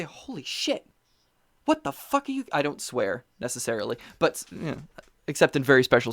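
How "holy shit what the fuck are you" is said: a hammed-up shocked gasp of disbelief; mock shock. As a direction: play it up like you can't believe it. Mock outrage at kids' stories.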